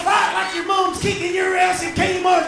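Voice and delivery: high, full-force shouting from the stands; rowdy high-pitched sports fan holler; crowd cheering. Acted. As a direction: bellow encouragement at the athlete. Crowd cheering on Olympic athlete.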